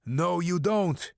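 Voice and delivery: put-on slow, menacing Russian accent; thick Russian accent. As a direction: drawl it out in a heavy Russian accent. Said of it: "with a thick Russian accent".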